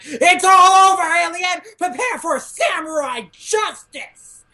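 rally the troops, all pumped up; hyped battle cry. Retold or imitated. Shinsengumi hyped to chase the alien, battle cry. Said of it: "and they're like".

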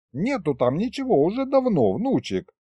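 lightly voiced grandpa. A narrator's reading. Grandpa setting his grandson straight, lightly voiced. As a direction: explain it as a fussy grandpa would.

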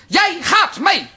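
no arguing, you are coming; stubborn demand. Acted. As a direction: put your foot down. Aunt insisting her niece come.

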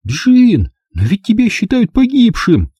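high, astonished blurt. A narrator's reading. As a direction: gasp it out in shock. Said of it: "выпалила". (потрясённо выпалила)

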